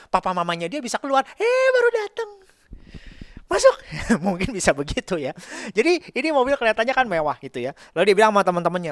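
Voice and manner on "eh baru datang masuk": mock welcoming parent. Parents voiced welcoming rich suitor.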